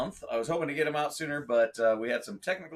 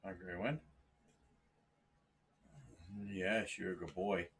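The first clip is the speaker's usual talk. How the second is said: lower, softer cooing to a pet; soft pet talk; 5 semitones lower, 7 dB quieter than usual. Real, not acted. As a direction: murmur fondly to the animal. Greeting the pet cat on camera.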